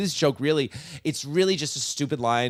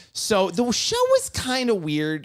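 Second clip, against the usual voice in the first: usual speaking voice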